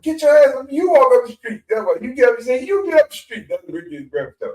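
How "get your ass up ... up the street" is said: much higher, louder shouting orders; high loud preacher shouting get out; yelling pastor. Retold or imitated. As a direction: run them off like an angry reverend. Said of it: "talking about".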